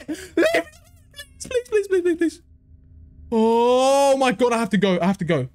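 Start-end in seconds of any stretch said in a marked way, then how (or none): 0.1-0.6 laughing plea. Real, not acted. Scared player pleading with monster. 1.4-2.4 high, rushed pleading please; half-laughing panicked begging; frantic begging. Real, not acted. Begging the game to let go.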